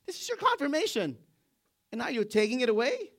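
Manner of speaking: high, loud protest at God; indignant Jonah. Acted. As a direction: Jonah outraged that his sign is taken away. Jonah voiced complaining to God.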